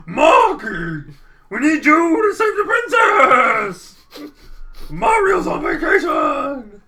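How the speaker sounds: high, loud, slow desperate shout; a panicked old steward yelling for help; frantic Toadsworth. Acted. Toadsworth shrieks his plea to Marky. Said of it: "He didn't talk like that".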